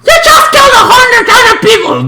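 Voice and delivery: fast, high, incredulous yell; high-pitched rapid-fire outrage; outraged shout. Acted. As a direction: shout it in mock disbelief. Mock outrage at the hero.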